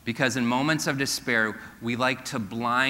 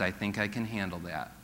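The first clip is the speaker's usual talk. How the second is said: God's calm reply; 4 semitones lower, 8 dB quieter than usual. Retold or imitated. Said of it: "And God says".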